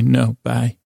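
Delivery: usual speaking voice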